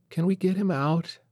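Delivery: put-on high, pleading small child; little girl voice. A narrator's reading. Little daughter begging her father to free the bear. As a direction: ask it like a hopeful little girl.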